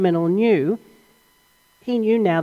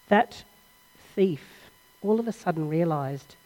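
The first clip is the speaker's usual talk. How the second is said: ordinary talk, nothing marked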